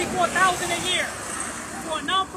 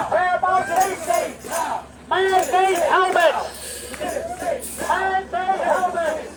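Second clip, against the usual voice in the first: ordinary talk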